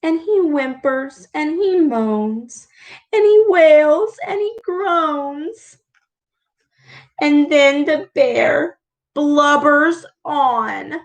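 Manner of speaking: gentle storyteller voice; read-aloud storytelling. A narrator's reading. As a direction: read it like a bedtime story to kids. Reads picture book aloud.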